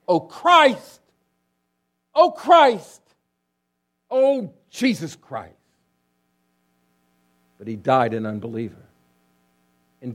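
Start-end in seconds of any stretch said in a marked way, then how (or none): 0.1-5.5 loud and much higher, anguished; a desperate deathbed wail of Oh Christ; anguished outcry. Retold or imitated. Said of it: "he cried out".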